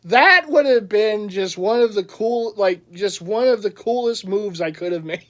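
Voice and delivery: ordinary talk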